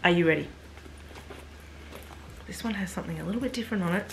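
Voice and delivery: usual speaking voice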